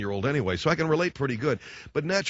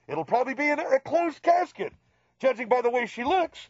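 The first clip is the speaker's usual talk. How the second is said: high, solemn pronouncement; mock grave dad; 14 semitones higher than usual. Dad solemnly announcing the death to his son, high voice. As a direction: deliver the bad news gravely, pitched up.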